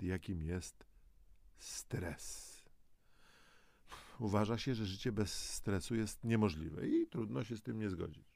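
normal talking voice

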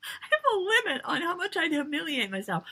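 high, laughing insistence; laughing protest. Real, not acted.

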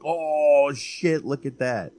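re-enacted excited movie-night outburst; impressed reaction. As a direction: exclaim like a wowed movie watcher. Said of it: "be like".

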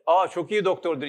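loud, cheerful exclamation; hearty acted approval; enthusiastic praise. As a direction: praise it loudly, oblivious to the answer. Deaf visitor praises the doctor obliviously.

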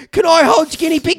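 put-on a dad putting on a child's pleading voice; child asking. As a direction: ask like an eager little kid. Kid asking, imagined.

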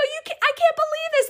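high, squealed disbelief; excited screaming. Retold or imitated. Screaming in her car at the good deed, excited screaming. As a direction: squeal it high, overcome with amazement. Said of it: "screaming in my car".

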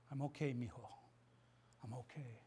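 calm your son with quiet love; tender reassurance. Retold or imitated. Father in pain reassuring his son, hushed and tender. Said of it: "look me in the eye and say".

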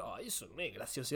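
very soft, low mock disapproval; disapproving imitation. Imitating themselves judging what others laugh at, quietly dismissive. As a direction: murmur a dismissive little judgment. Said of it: "que la gente se ríe y decir".

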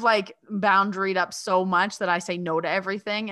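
normal talking voice

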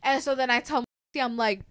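usual speaking voice

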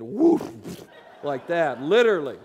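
loud burst of a woof. Retold or imitated. Preacher showing flash paper burst into flame, loud woof. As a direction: make the flame go up.

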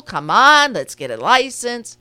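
loud, fed-up scolding; exasperated. Real, not acted. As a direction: come on, chiding the listeners. Exasperated aside about unlicensed drivers.

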